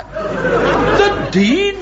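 high, drawn-out astonished question; shocked disbelief. Acted. Bishop hearing gossip about the Dean, shocked. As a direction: stretch it out in scandalised amazement.

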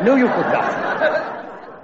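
slow, drawn-out, high no; mock-indignant slow refusal. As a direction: stretch the refusal out slowly, half laughing. Bishop firmly refuses.

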